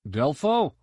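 high call down the corridor. Acted. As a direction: shout the name to find him. Trooper calling for comrade.

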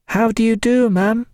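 put-on high little girls' greeting; girls in unison. A narrator's reading. Little girls greeting the hostess together, high-pitched in unison. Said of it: "they said in unison".

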